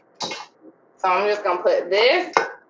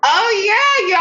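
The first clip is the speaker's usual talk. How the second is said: high, loud burst of joy; thrilled at the milestone; excited; 10 semitones higher, 5 dB louder than usual. Real, not acted. Thanked for 500 subscribers.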